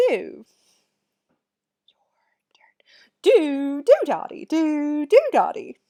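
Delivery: hammed-up English accent, loud with swooping pitch; exaggerated English accent, loud and theatrical. Shown as a demonstration. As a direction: chant it in a silly mock-English accent. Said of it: "say it with our best English accents".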